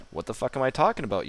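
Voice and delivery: puzzled viewer. Acted. Voicing a viewer questioning the streamer's rambling, puzzled. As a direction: blurt it out as the baffled audience. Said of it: "you might be saying".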